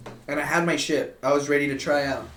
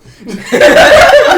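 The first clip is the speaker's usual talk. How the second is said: high shrieking laugh; 17 dB louder than usual. Real, not acted. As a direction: burst into a high squealing laugh. Bursts out laughing at joke.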